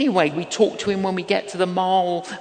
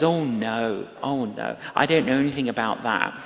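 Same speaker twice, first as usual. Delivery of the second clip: apologetic no; 8 semitones lower, 5 dB quieter than usual. Retold or imitated. Voicing a stranger's apologetic reply.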